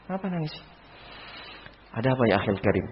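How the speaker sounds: soft, caring question to a crying brother; softly asking a weeping friend what is wrong; tender comforting. Retold or imitated. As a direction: coax him gently to open up.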